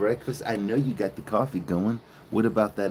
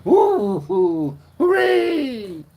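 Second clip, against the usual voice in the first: high, loud whooping cheer; mock cheer; 12 semitones higher, 7 dB louder, much slower than usual. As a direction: whoop like the happy cows. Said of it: "you just like".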